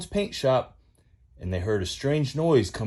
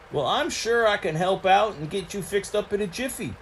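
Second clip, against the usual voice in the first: higher, twangy country tow truck; lightly pitched-up country boy voice; folksy tow truck Mater; 9 semitones higher than usual. Acted. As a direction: play Mater the rusty tow truck, eager to spill news.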